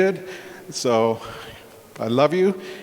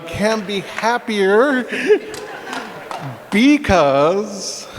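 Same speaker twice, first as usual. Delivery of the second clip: laughing excitement; 5 semitones higher, slower than usual. Real, not acted. Brother teases the retiree, laughing and rising. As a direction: tease him with real joy.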